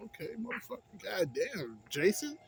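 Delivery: mock awe at Jason's moves; impressed inner voice. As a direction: marvel at the fighter's skills. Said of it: "watching shit, like".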